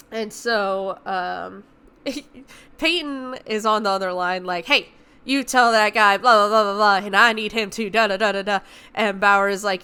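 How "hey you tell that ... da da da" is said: loud mock orders. Peyton barking orders over radio.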